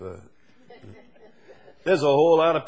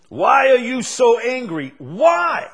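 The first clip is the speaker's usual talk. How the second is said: preaching shout; 12 semitones higher, 11 dB louder, slower than usual. Acted. Preacher repeats his question.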